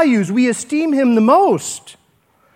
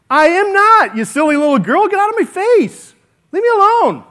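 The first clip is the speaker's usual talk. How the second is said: angry denial; 9 semitones higher than usual. Retold or imitated. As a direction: snap at the girl, pitched up. Peter denies angrily to girl.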